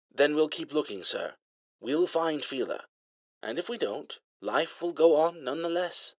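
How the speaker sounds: a dry English AI teasing his master; light English accent. A narrator's reading. Al reassures Jarek.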